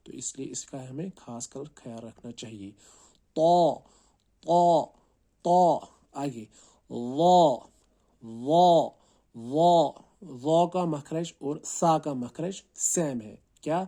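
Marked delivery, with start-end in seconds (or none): 3.3-5.8 تو said thick and full, loud; teacher contrasting heavy ط with thin ت; heavy letter. Shown as a demonstration. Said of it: "تو کو ہمیں موٹا پڑھنا ہے". 6.9-9.9 ظ said thick and loud; teacher modelling a thick ظ; heavy letter. Shown as a demonstration. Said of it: "و کو موٹا پڑھنا ہے".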